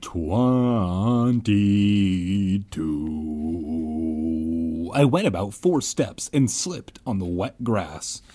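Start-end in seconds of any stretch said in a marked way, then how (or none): 0.0-4.9 slow, drawn-out title read; grand chapter title voice; dramatic chapter announcement. Acted. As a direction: announce it like a movie trailer.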